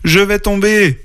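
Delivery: put-on high-pitched child's cry; alarmed cry. A narrator's reading. Little sister about to fall off the trunk, alarmed. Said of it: "cria petite sœur".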